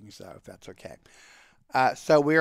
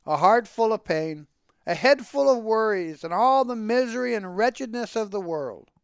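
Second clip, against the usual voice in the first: high, animated patter; exclaiming, theatrical storyteller's Tevye; forced cheerful; 6 semitones higher than usual. A narrator's reading. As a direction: rattle off woes with put-on cheer. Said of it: "trying to sound cheerful".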